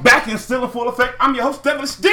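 shouted hype announcer intro; radio host intro. Acted. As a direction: hype up the show opener, loud and high.

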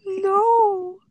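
high, loud, stretched-out no; mock-mortified wail of denial; retold embarrassed denial.

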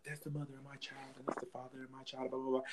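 quick, quiet mock patter; mocking mimicry. Quoting people's excuses about their child's parent, dismissive mimicry.

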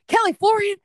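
high, loud shriek of disbelief; outraged dad. Retold or imitated. Dad realizing the agent is his daughter, shrieking in disbelief. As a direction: screech it like a dad catching his kid out. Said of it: "And Dad goes".

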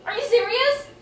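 exasperated mom, high, disbelieving; high incredulous. Retold or imitated. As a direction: squeak it out, stunned and fed up. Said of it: "it's like".

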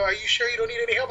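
high-pitched imitation of a concerned girlfriend; fussing girlfriend. Girlfriend fussing over him.

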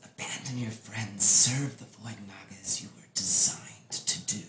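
low, soft creature whisper. Acted. Naga urges Aki to abandon friends, whispering. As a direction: whisper a command to obey. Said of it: "you can hear them whispering".

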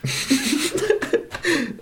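high, soft laugh; amused high giggle; laughter. Real, not acted. Listener amused by the tea verdict.

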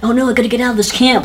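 put-on high, whiny horror-movie teen; mock panicked teen. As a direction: play a scared camp kid. Mocking horror movie teens.

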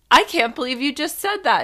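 put-on light up like a child at the ice cream truck; excited, high-pitched amazement; delighted disbelief. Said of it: "my eyes lit up like a child seeing the ice cream truck".

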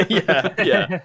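high, breathy giggling yeah; laughing. Real, not acted. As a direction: laugh through the words.

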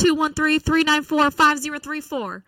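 hammed-up radio pitch. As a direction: punch out the number like a commercial. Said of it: "where this accent is coming from".

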